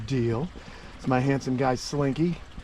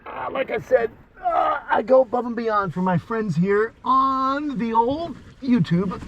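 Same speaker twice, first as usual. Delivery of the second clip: excited shout; 8 semitones higher than usual. Real, not acted. Hyped address to viewers.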